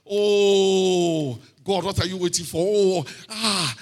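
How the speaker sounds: long, high groaned "oh", acting out frustration; exasperated complaint. Worshipper pleading with God over delayed help, acted exasperation. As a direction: moan up to God like someone tired of waiting. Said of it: "And you're like".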